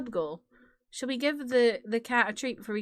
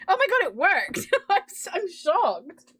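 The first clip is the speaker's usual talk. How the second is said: excited surprise; 7 semitones higher than usual. Real, not acted. Voice clip test succeeds.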